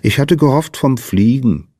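put-on mildly let-down little girl; a touch of wistful disappointment; disappointed murmur. A narrator's reading. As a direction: sigh it out as a disappointed child. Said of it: "murmelte Lina".

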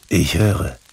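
ordinary delivery, nothing marked

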